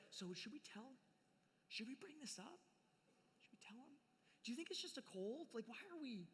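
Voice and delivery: hushed and nervous, almost breathless; hushed worried whisper. Retold or imitated. Disciples fretting to each other in hushed tones.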